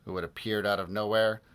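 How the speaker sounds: ordinary delivery, nothing marked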